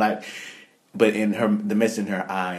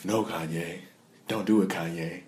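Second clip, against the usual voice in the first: dropping voice deep; 3 dB quieter than usual. Retold or imitated. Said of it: "Why do you make her voice sound so deep".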